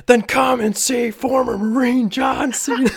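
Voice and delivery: high, very loud hype-announcer shout; mock announcer blast. Prank ad bursting in on the lady, mock announcer yell. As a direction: blare it out like a wrestling promo. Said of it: "it just goes back into".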